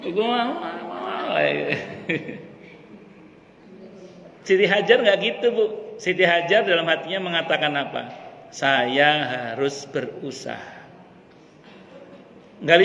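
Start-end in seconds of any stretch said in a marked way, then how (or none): 0.0-2.4 high drawn-out mimicked wail; mock grumbling wail. Preacher mimics someone busy grumbling, mock wail. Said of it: "Sibuk, sibuk ngomel-ngomel". 8.5-10.8 slow, measured inner voice; slow resolve. Retold or imitated. Preacher tells what Hajar said in her heart, slow resolve.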